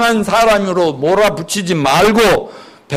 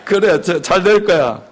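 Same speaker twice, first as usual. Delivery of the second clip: ordinary delivery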